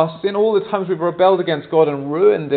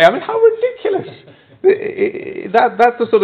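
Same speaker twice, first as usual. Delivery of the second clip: laughing at himself; 10 semitones higher, 4 dB louder than usual. Real, not acted. Laughing at his own eBay anger.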